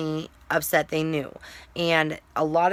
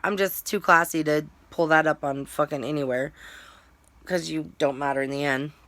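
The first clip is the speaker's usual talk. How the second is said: usual speaking voice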